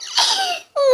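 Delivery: high-pitched burst of laughing; loud laughter. Acted. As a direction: crack up at your brother. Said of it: "मुझे तो बहुत हंसी आ रही है". (ज़ोर की हँसी)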